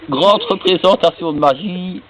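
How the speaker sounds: high, loud fairground announcement; showman announcer. Acted. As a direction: sell the show to the crowd. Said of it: "il monte sur son estrade".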